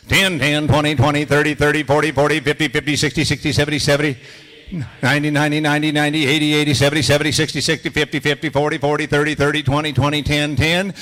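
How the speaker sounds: loud rhythmic count, each number said twice; number scale drill. Shown as a demonstration. Auctioneer leading students through number scales, loud and rhythmic. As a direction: bark the numbers up and down like a bid call. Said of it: "number scales".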